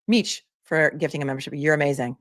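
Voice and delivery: ordinary talk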